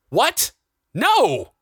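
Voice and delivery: very high, shocked outburst; explosive protest. A narrator's reading. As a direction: burst out in horrified refusal. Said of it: "Something inside Jonathan exploded".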